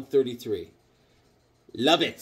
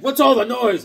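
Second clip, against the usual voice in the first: monster waking; 8 semitones higher, 7 dB louder than usual. Retold or imitated. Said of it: "and he's like".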